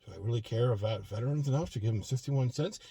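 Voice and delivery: imagined inner thoughts. Retold or imitated. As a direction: muse to yourself like a stingy guy weighing pennies. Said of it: "this is what I think he's thinking".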